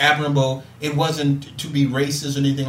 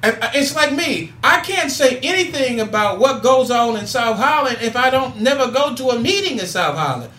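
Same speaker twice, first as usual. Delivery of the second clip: higher, driving preacher's cadence building up; impassioned call to action; preaching; 7 semitones higher than usual. Acted. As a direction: fire up the audience like a Sunday sermon. Rises into preaching to listeners.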